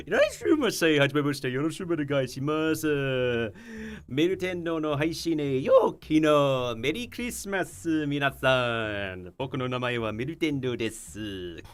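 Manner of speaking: put-on high sing-song anime-girl Japanese greeting; cute anime greeting. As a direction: chirp it like an anime idol. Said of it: "or as we welcome everybody in Japanese, my broken Japanese".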